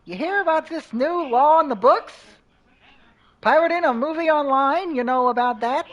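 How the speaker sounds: high, loud reporter voice; news reporter voice. Acted. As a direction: read it like a cheesy newscaster. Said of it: "news reporter voice".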